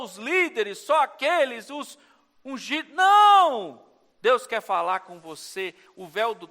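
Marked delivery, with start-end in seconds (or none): 2.9-3.9 high, loud, flat rejection; shouted no. Real, not acted. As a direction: cry out a sharp no. Preacher rejects old teaching. (grito forte de negação)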